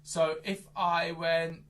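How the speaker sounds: normal talking voice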